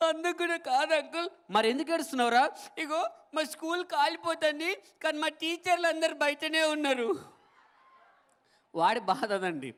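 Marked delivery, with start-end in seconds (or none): 0.1-7.4 put-on gleeful child. Schoolboy telling the uncle the real reason, high and giggling. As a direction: let the kid gloat about the trapped teachers.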